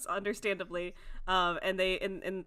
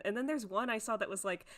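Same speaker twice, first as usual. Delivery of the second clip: usual speaking voice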